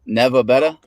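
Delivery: lightly imitated Boston accent; a hint of Boston, rising at the end. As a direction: try out the Boston accent, questioningly. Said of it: "Isn't that a Boston accent?".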